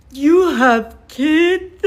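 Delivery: high, loud, slow drawn-out disbelief; mock shocked chorus. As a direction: act out the whole group gasping the question. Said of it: "An eruption of".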